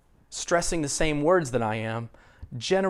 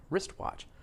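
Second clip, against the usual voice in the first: ordinary talk, nothing marked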